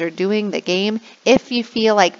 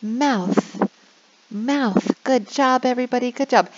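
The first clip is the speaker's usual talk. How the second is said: teacher voice; 5 semitones higher than usual. Shown as a demonstration. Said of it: "But I'm like".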